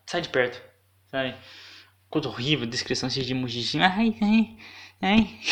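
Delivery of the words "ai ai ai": ais agudos virando gargalhada; rindo alto. Real, not acted. (risada aguda descontrolada)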